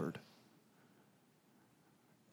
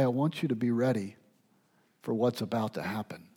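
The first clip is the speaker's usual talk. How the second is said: much softer and fast, a near hush on "for what's about to happen"; kind; 5 semitones lower, 10 dB quieter, faster than usual. Retold or imitated. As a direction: tell them gently so they are ready. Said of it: "The kindness of Jesus".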